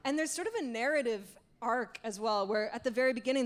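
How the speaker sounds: ordinary talk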